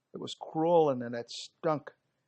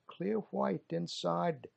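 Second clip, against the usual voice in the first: usual speaking voice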